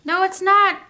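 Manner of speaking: loud, high shout. Acted. Ruthie yelling at Martin over the camera, shouting. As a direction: shout it like a kid losing a fight. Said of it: "shouted Ruthie".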